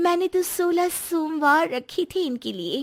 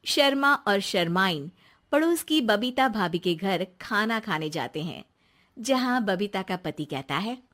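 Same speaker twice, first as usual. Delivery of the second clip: lower, calm narrator voice; story narration; 7 semitones lower than usual. A narrator's reading. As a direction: set the scene like a storyteller. Narrator introduces scene. (कहानी सुनाने वाली, धीमी और नीची आवाज़)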